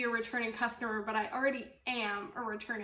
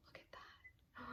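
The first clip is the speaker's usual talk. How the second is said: barely voiced, marvelling; awed whisper; 25 dB quieter than usual. Real, not acted. To viewers over the pretty yarn, an awed whisper. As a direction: breathe it out in a whisper.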